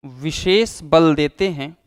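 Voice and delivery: slow, spaced dictation with long pauses; teacher dictating notes, one phrase at a time; dictation pace. Shown as a demonstration. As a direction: read it out phrase by phrase for students to write down. Dictating the definition.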